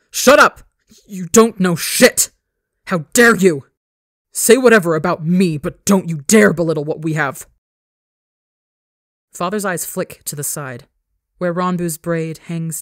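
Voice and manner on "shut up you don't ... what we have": snarling scream. Acted. Son slamming father against the wall, screaming. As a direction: scream it in his face.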